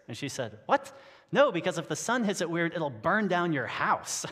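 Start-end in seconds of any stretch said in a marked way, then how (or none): 0.7-1.0 sharp, high, loud startled what; baffled what. Retold or imitated. Crystal ball seller, baffled reply. 1.3-4.2 high, loud, matter-of-fact correction; emphatic retort. Retold or imitated. Seller explains the real danger.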